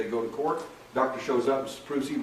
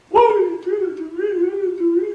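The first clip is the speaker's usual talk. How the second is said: much higher, louder wailing plea; imitating a sobbing woman; 10 dB louder than usual. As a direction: cry it out like the woman on the table, voice way up. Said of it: "She cries like a baby".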